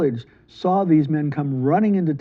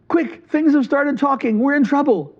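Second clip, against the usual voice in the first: much higher, urgent panicky voice; panicked; 9 semitones higher, 3 dB louder than usual. Acted. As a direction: blurt it out in a fright. Frightened men run to merchant.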